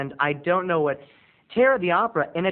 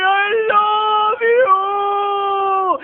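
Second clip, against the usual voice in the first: drawn-out dying shout; 9 dB louder, much slower than usual. Retold or imitated. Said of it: "and he's like".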